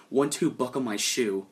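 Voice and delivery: normal talking voice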